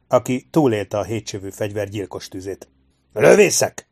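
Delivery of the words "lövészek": loud, high rallying call; shouted command. A narrator's reading. Sergeant summoning his riflemen in battle, shouted command. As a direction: yell for the troops. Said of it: "kiáltotta Harper".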